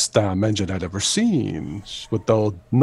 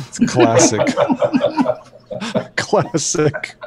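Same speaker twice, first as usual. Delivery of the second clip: normal talking voice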